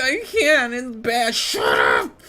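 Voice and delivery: put-on high, loud whiny kid protest; a child shouting back at a yelling parent; mock kid protest. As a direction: wail it like a kid refusing food. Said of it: "You're like".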